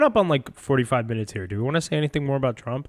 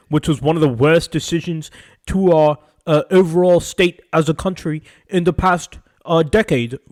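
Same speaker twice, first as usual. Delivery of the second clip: higher-pitched, gloating Brit caricature; mock British accent; 4 semitones higher, 5 dB louder than usual. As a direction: put on a smug British accent. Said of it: "They'll be like".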